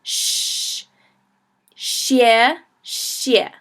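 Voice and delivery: teacher modelling the sh in xie; xie with sh sound. Shown as a demonstration. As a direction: say xie with sh, not x. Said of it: "the X makes an S H sound".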